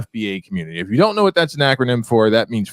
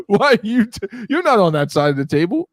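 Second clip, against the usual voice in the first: normal talking voice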